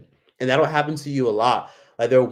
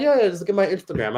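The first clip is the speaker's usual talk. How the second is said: breezy brush-off; 6 semitones higher than usual. Retold or imitated. Her brushing off.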